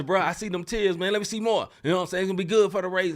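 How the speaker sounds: high, loud eager prodding; hammed-up hungry interviewer; mock greedy reporter. Mock reporter wanting tears.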